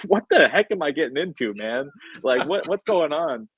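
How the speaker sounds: high, rising alarm at what's coming; half-joking shocked exclamation; alarmed disbelief. Retold or imitated. Retold alarmed reaction at seminar.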